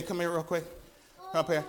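usual speaking voice

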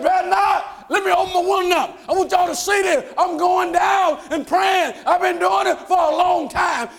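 high, loud bold declaration; defiant Daniel. Retold or imitated. Daniel answering the officials who forbid prayer, defiant shout. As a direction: throw the defiance back at them.

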